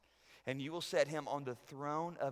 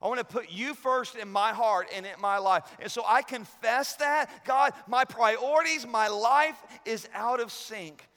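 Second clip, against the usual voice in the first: impassioned loud prayer; 8 semitones higher, 10 dB louder than usual. Acted. Preacher confessing misplaced priorities to God, impassioned loud prayer. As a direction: cry the confession out to God at full strength.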